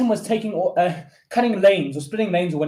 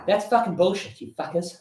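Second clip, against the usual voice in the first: normal talking voice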